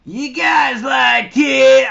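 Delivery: a loud, slow mock proposition; skanky woman's voice. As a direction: ham up the crude toothless woman. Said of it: "That's how she sounded".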